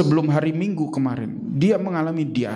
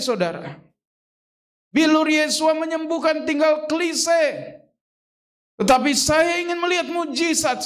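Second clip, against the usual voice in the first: high, pleading preacher's cry to God; fervent prayer; 10 semitones higher than usual. Retold or imitated. Preacher retelling his prayer to God, high fervent cry. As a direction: cry out to God with rising fervor.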